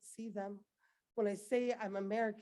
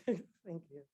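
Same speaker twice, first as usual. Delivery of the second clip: normal talking voice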